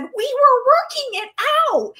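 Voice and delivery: a hint of a child defending themselves; kid protesting. Child answering mom's yell, lightly mimicked high whine. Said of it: "and then somebody said".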